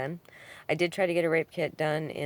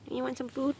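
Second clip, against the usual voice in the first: pet talk; 10 semitones higher than usual. Real, not acted. Offering food to cat.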